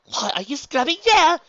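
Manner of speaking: high, loud indignant cry; startled protest. Acted. Luna objecting to being scrubbed, startled. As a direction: yelp it in alarm.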